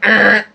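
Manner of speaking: high strained buzz "Er"; mock door buzzer blurted out; buzzer noise. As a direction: buzz like a rejecting intercom. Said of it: "you're stressing the voice".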